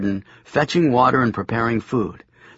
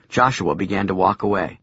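ordinary delivery

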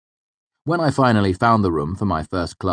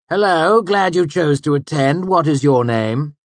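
much higher, louder, sugary sarcastic greeting; mock-welcoming Hemme; 9 semitones higher, 8 dB louder than usual. A narrator's reading. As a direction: greet the latecomer with false warmth. Hemme mocks the late student.

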